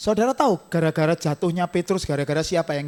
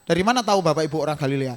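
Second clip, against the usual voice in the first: ordinary delivery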